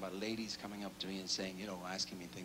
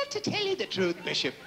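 lightly louder Irish lilt; Irish priest; 7 semitones higher, 7 dB louder than usual. Acted. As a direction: priest answers in a light brogue. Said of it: "He says".

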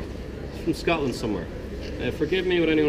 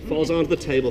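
normal talking voice

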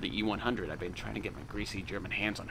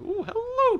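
high squealed hello; 9 dB louder, slower than usual. Acted. Squealed greeting to enemy tank.

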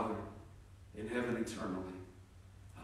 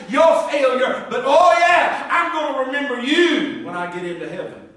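mock-sarcastic, bitter, building louder; sarcastic retort; 4 semitones higher, 6 dB louder than usual. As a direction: sneer it like a man in pain being asked a favor. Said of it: "probably sarcastically".

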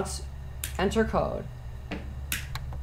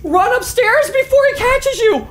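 high, frightened wail; panicked cry; 10 semitones higher than usual. Real, not acted. Player fleeing upstairs from the killer, panicked cry. Chased in the game.

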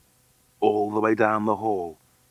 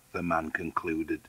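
usual speaking voice

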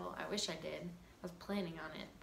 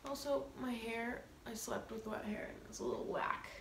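normal talking voice

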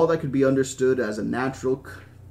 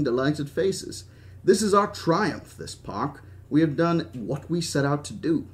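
put-on proud old British showman, lingering on key words; Hammond. Park owner proclaiming his triumph to Henry, lingering British voice. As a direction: declaim with a mild British accent.

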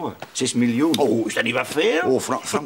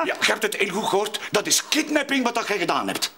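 accusing; 8 semitones higher than usual. Acted. Accusing father of kidnapping.

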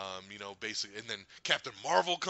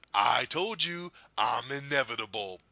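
slow, drawn-out villain pronouncement; Thanos; 4 dB louder, slower than usual. Retold or imitated. Fan doing the line before the snap, slow villain declaration. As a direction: imitate a villain declaring his triumph. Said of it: "then he does the".

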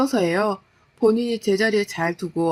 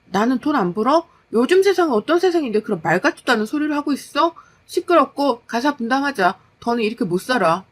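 higher, heated retort; exasperated wife; 3 semitones higher than usual. Acted. Wife demanding her husband share the housework, exasperated. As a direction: put your foot down, exasperated.

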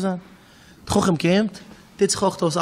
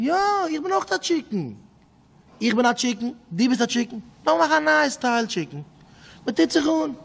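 high insistent voice; 7 semitones higher than usual. Acted. Prince insists he is chicken.

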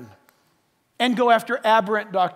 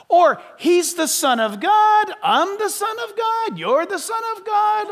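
mocking false teaching; 8 semitones higher than usual.

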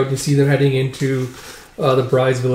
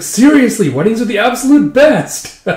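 high, loud gush breaking into a laugh; gushing delight; 8 semitones higher, 5 dB louder than usual. Acted. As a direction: rave about the wedding, laughing. Excited wedding guest gushing.

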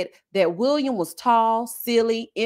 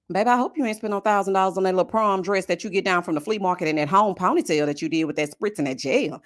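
normal talking voice

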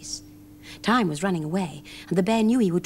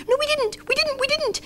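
very high, tiny squeaking voices; high mouse chorus protesting; squeaky mice. A narrator's reading. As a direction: chatter it high like frightened little mice. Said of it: "said the mice".

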